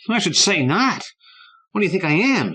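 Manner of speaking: put-on high, loud, offended kid; indignant little brother. A narrator's reading. Little brother promising to stay safe, high indignant scoff.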